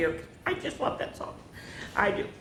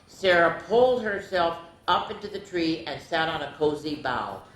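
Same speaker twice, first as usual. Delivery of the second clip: plain reading voice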